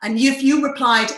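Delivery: ordinary talk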